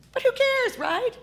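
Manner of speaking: high mocking sarcasm; sarcastic. Real, not acted. Host mocking politicians who ignore kids, sarcastic. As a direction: sneer at the indifference.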